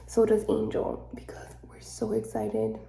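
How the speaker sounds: soft hushed whisper. Real, not acted. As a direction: keep it a whisper. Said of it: "I have to whisper".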